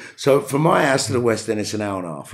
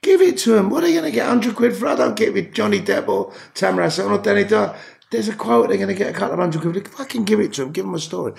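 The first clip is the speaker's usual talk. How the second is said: higher-pitched, worked-up insistence; emphatic urging; 10 semitones higher than usual. Real, not acted. Actor urging famous people to indulge freezing photographers, emphatic and high. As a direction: plead the case with rising exasperation.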